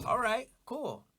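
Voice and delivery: high-pitched mock approval; imitated interviewers. Interviewers reacting to his story, imitated high-pitched approval. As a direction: lift it high, as a quick retold okay.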